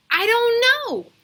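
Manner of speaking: high, loud, slow exasperated wail. Acted. Calvin overwhelmed by questions, slow high wail. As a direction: throw the words up in despair. Calvin throws up his hands.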